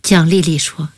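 ordinary delivery, nothing marked

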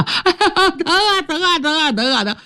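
高声哀叫喊疼; 疼得直叫唤; wailing in pain. Acted. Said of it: "疼得六指直哀呀". (六指被碰到箭杆疼得哀叫)

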